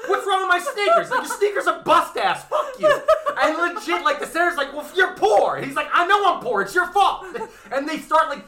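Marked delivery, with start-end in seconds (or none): 0.1-3.0 much higher, louder, laughing; squeaky mock senator. Senator squabbling with soldier over sneakers, squeaky laughing voice. 4.7-7.3 much higher and louder, half laughing; whiny back-and-forth; squeaky mock quarrel. Senator and soldier trading insults, squeaky mock whine.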